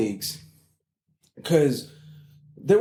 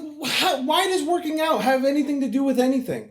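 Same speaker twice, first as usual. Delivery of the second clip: baffled; 11 semitones higher than usual. Retold or imitated. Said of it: "I'm like".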